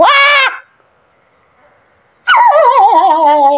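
high startled shriek then yelps; real jump-scare shriek; startled scream. Real, not acted. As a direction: jump out of your skin at the scare. Jump scare in horror game.

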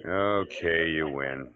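normal talking voice

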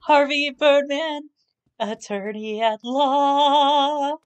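slow, high, drawn-out title call; announcer voice. Retold or imitated. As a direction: announce the show title grandly.

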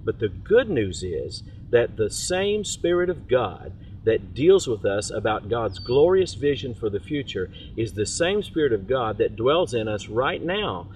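ordinary talk, nothing marked

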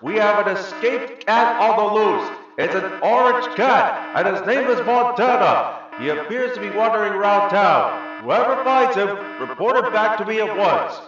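high, loud announcement to everyone; guard yelling. A narrator's reading. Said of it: "One of the guards yelled".

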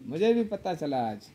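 ordinary talk

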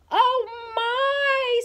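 high, loud exclamation; startled, high-pitched cry; shocked mother. A narrator's reading. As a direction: exclaim it high, taken aback. Mama shocked by the mess.

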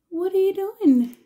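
high, loud, slow pet talk; sing-song question to the pet. Real, not acted. Voicing pet in baby talk.

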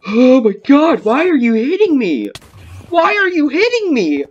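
high, loud panicked shrieks; panicked screaming. Real, not acted. Player yelling at an attacker hitting them, panicked screaming.

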